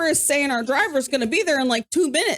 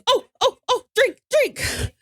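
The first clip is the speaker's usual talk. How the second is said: driver with broken English; 9 semitones higher, slower than usual. Retold or imitated. Uber driver warning the drunk about his beer, high slow. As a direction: call it out slow like someone with little English. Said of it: "And the Uber driver goes".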